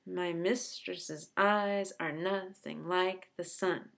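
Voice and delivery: slow line, leaning on every second syllable; exaggerated iambic stress. Shown as a demonstration. Teacher showing students the rhythm of the sonnet line, exaggerated iambic stress. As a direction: beat out "My mistress's eyes are nothing like the sun" in da-DA pairs. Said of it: "I'm exaggerating it".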